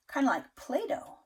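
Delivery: plain reading voice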